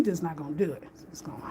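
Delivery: conspiratorial hushed aside; whisper. Real, not acted. Whispering to viewers that the mopping won't happen. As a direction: whisper it like a secret. Joking about the mop.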